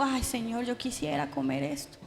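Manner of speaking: soft, hushed little prayer of craving; quiet wishful plea. Retold or imitated. Churchgoer quietly telling God what she craves. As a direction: murmur it like a private wish.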